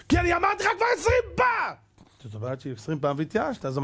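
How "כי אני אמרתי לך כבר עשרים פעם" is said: very high, strained parental yell; exasperated shouting. Acted. Parent explaining to a child why he shouts, exasperated yell. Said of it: "למה אני צועק?".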